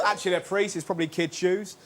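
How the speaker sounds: ordinary delivery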